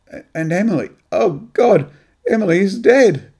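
realizing his own daughter is gone; sudden shock. Acted. Graham realizes the dead woman was his daughter, shocked cry. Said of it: "Then with a sudden shock".

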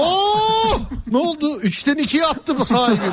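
high, delighted exclamations; excited car owner. Retold or imitated. Mock car owner amazed at his engine. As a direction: marvel at the gearshift.

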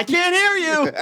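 high laughing cheer demand; cheerleader call-back. Pretend. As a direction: egg the crowd on. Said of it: "cheerleader routine".

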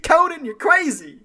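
very high, loud, giggling flattery; sycophantic sidekick, laughing. Pretend. Imagining himself as the house band flattering Conan, very high and laughing. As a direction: gush over the host, cracking up.

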